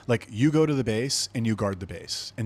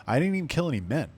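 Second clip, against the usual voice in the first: usual speaking voice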